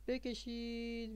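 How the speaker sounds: slow, sustained, high and soft; drawn-out word. Shown as a demonstration. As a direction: stretch the word out like a long pen stroke. Voice mimics drawing a long line.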